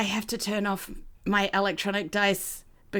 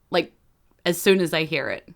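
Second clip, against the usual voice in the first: ordinary talk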